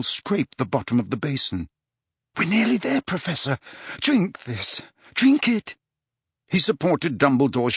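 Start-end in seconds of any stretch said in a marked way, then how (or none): 2.4-5.8 high, anxious coaxing; worried, insistent urging; urgent encouragement. A narrator's reading. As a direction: push him on through the last bit. Harry urges Dumbledore to drink.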